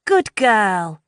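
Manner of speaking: put-on high pleased teen voice; approving friend. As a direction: praise her like a bossy friend. Lou approving.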